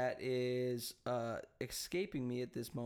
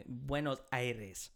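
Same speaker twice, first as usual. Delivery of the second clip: Spanish accent; slower than usual. Shown as a demonstration.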